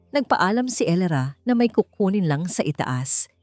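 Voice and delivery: usual speaking voice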